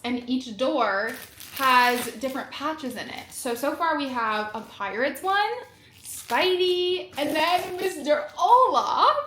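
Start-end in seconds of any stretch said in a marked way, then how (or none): 6.0-9.2 excited. Real, not acted. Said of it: "I'm, like, literally shaking".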